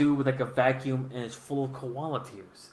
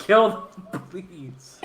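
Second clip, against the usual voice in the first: much higher and louder through laughter, then a fading please; laughing mock plea; 6 semitones higher, 8 dB louder, much slower than usual. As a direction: beg for it while cracking up. Mock plea ending joke.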